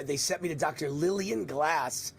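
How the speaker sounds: ordinary talk, nothing marked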